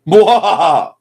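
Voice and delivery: loud, slow evil cackle; sneering panto villain. Pretend. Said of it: "need to rein in my dark humor".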